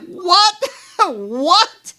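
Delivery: high, laughing disbelief; incredulous what. Retold or imitated. Laughing in disbelief at the boss's call. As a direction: squeak out a stunned what.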